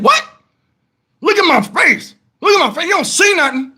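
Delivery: high, pleading repetition; exasperated insisting. Retold or imitated. Stung man insisting his wife look, exasperated. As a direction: beg her to look, voice up.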